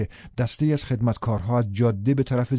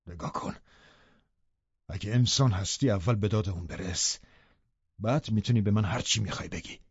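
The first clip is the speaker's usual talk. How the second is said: soft, hushed demand; quiet, controlled challenge; restrained command; 2 semitones lower, 6 dB quieter than usual. A narrator's reading. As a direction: order him quietly, holding back. Lover confronts husband holding Catherine.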